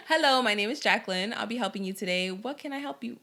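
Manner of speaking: lightly polite phone greeting that slips; half-hearted professional voice; customer service voice. Shown as a demonstration. Guest to host, practicing a customer service greeting. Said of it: "customer service voice".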